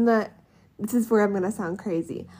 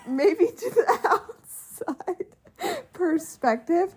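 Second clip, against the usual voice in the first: high, slow, through laughter; real laugh breaking up the words; laughing concession; 8 semitones higher, slower than usual. Real, not acted. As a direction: squeak it out while laughing.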